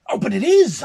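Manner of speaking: sharp, loud, high irritated retort; irritated snap. Acted. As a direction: snap it back at him, annoyed. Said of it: "I snapped with irritation".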